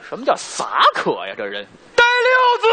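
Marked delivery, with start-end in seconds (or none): 1.9-2.7 bandit's summons. Pretend. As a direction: yell for the henchman across the hall. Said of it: "电影里头那个一喊那带柳子".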